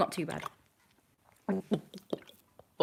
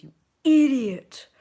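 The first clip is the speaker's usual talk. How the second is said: loud, slow mock scolding of herself; self-scolding; 7 semitones higher, 9 dB louder, slower than usual. Real, not acted. Vlogger correcting her own maths mistake, mock scolding. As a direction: call yourself an idiot, loudly.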